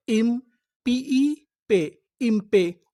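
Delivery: very slow syllable sounding. Shown as a demonstration. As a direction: spell पीई पे out slowly. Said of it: "syllable reading demo". (धीरे-धीरे अक्षर पढ़ना)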